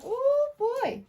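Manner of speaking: high squeal of surprise; surprised. Real, not acted. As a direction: yelp it, caught off guard. Reacts to card jumping out.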